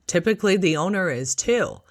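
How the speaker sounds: ordinary talk, nothing marked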